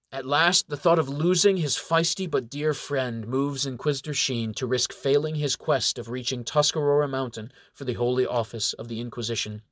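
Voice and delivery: ordinary delivery, nothing marked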